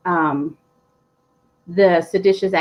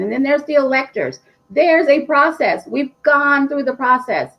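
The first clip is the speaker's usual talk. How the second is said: higher, hammering each step out in frustration; exasperated insistence; 7 semitones higher than usual. Real, not acted. Vlogger venting at lawmakers after the Capitol riot, exasperated and emphatic. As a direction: lay down the process like it should be obvious. Heated rant about the election process.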